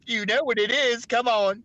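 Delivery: normal talking voice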